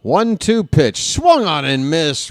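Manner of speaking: high, loud and slow on the count; excited pitch call. Real, not acted. Announcer calling a big strikeout, excited and drawn out. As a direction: lean into the strikeout.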